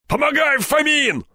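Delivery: high-pitched shout for help; urgent shout. A narrator's reading. Степаныч hauls the rope, shouting for Фомин's help. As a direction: holler to your partner for help.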